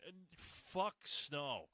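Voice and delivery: normal talking voice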